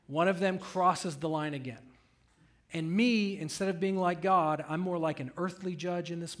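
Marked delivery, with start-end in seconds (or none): none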